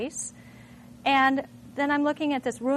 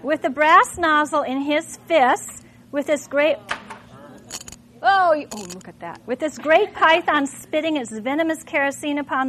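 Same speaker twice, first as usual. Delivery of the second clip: dramatic reading; 3 semitones higher, 7 dB louder than usual. Acted. Teacher reads Fahrenheit passage to class, dramatic read. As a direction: read it like the flames are roaring.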